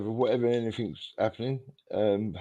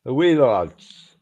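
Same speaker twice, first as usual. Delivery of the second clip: drawn-out, shaky attempt at Geordie, louder; attempted Geordie accent; 2 semitones higher, 5 dB louder, slower than usual. Shown as a demonstration. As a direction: have a slow go at a Geordie accent. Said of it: "Can you do a Geordie accent?".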